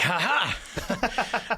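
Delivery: high mock "ha ha"; imitated scornful laugh. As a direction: imitate a relative laughing in disbelief. Mother-in-law's retold laugh at him.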